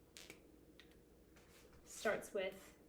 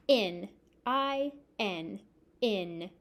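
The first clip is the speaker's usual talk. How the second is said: slow spelling; much slower than usual. Shown as a demonstration. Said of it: "spelling aloud slowly".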